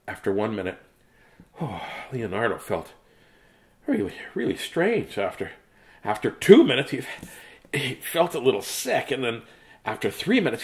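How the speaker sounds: plain reading voice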